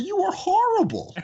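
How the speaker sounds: high, loud teasing yell; playful shout. Retold or imitated. Owner jokingly yelling at a complaining customer, loud tease. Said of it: "I said".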